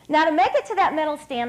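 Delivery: ordinary talk, nothing marked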